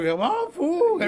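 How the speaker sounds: very high cracking squeal. Retold or imitated. Recalling friend's voice cracking while begging, squealing high. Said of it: "I could hear his voice crack too".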